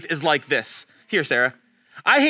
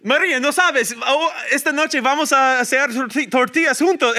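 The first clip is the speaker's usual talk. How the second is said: excited, higher-pitched rush of Spanish; super excited, really fast; 4 semitones higher than usual. Retold or imitated. Telling a kids' club mom about tortillas, super excited. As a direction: blurt out the plan excitedly. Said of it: "I said it really fast, and I was super excited".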